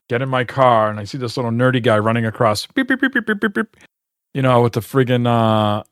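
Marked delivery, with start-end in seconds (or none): 2.7-3.8 high-pitched beep beep like a reversing truck; imitated beeping alarm. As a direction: mimic the warning beeps, much higher.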